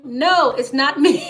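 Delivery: laughs through a denial; real amused laugh breaking out; cracking up at the absurd mix-up. Real, not acted. Laughing at a scammer's confusion.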